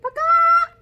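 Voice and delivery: very high, loud hen call on paka; high clucking hen noise. Shown as a demonstration. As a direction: squawk it like a hen. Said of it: "We have a hen".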